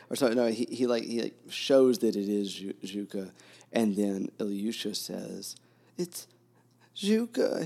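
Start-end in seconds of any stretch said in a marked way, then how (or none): 6.0-7.6 cried out much higher and louder, voice breaking; a sudden cry, joy and pain cracking through. Retold or imitated. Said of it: "he cried out suddenly, his voice cracked".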